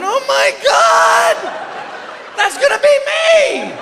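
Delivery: high, slow comic wail; mock horror. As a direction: wail in hammed-up dread. Acts out his horror at farting.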